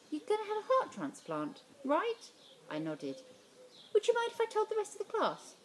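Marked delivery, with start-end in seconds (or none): 0.1-2.3 teacher voice. Acted. 3.9-5.5 high, far-off teacher voice. Acted.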